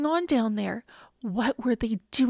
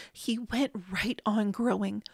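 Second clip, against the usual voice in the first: plain reading voice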